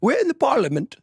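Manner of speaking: high-pitched mock British accent; hammed-up British accent. Shown as a demonstration. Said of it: "if I do a British accent".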